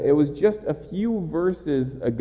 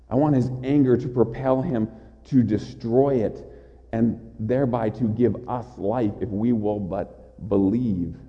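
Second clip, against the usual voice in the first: usual speaking voice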